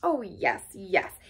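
brushing off the reproach; impatient. A narrator's reading. Toad waving away Badger's question, impatient. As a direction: concede it with testy impatience. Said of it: "said Toad impatiently".

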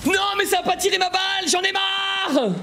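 high, drawn-out gamer rage; exasperated wailing. Real, not acted. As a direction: wail in outrage, stretching the last words. Joueur excédé de perdre. (hurlement plaintif, aigu et excédé)

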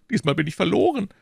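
high, hopeless lament; despairing shopkeeper. Acted. Shopkeeper led away, high despairing lament. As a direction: give up all hope.

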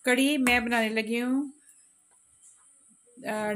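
ordinary delivery

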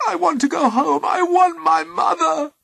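high, loud tearful wail; sobbing. A narrator's reading. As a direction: wail it like a distraught daughter. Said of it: "sobbed Persephone".

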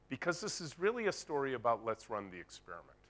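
ordinary talk, nothing marked

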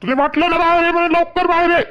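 fast, loud, high-pitched order; angry shouting. Acted. Husband orders wife outside, shouting impatiently. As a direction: yell impatiently toward the house. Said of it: "एवढे कोण ओरडून राहिले तुम्ही".

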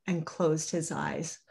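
ordinary delivery, nothing marked

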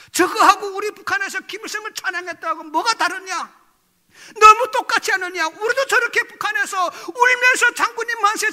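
loud, high-pitched rhetorical challenge; indignant disbelief. Retold or imitated. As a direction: retell a scornful inner protest at full voice. 교인들을 보며 품은 의심의 항변. (어이없다는 듯 높고 크게 반문하며)

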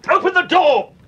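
high, loud, desperate yells; desperate shouting. Acted. Locked in, shouting to Sir Graham and Perry, desperate. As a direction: pound on the door and call for help.

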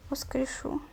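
whispered promise, trailing off. Real, not acted. Gamer promising the fallen girl a revive, whispered. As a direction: breathe the last word.

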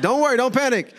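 high-pitched comic placating; calm them with exaggerated alarm; mock-frantic soothing; joking reassurance. Retold hasty reassurance to startled woman.

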